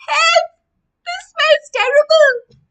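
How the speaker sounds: high, loud desperate cry for help; acted shout for help. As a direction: yell it as a choking neighbor. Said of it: "They were like".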